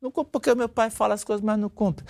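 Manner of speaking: put-on a child refusing to trust dad's word; distrustful child. A disappointed child answers the father, a lightly voiced child.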